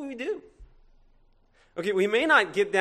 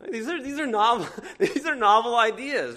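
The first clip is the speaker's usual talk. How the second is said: laughing; 5 semitones higher, 5 dB louder than usual. Real, not acted. Joking aside, laughs while saying it.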